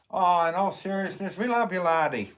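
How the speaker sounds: loud, high hammed-up Scottish brogue; bad Scottish accent. Reading a chat message to a viewer in a bad Scottish accent. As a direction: overdo a Scottish accent, it slides toward Irish. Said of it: "that was a horrible impression".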